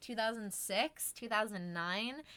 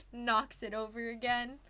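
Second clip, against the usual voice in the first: ordinary delivery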